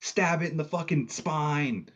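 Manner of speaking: high, drawn-out cheering yell; re-enacted yell. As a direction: yell it like egging on a fighter. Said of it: "I fucking yelled".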